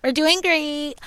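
high, drawn-out mock parent brag; doting parent act. Telling the host the relationship's age like a baby's, cooing. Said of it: "I'm pretending like it's a child".

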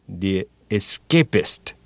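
slow, tentative tasting of the name; trying the name out. Acted. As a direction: say it slowly, testing it. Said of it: "Joe tried it out".

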